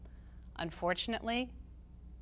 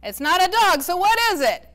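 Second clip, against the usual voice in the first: higher and louder, demanding; 10 semitones higher, 11 dB louder than usual. A narrator's reading. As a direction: press her for the answer. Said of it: "he demanded".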